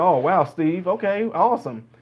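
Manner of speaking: usual speaking voice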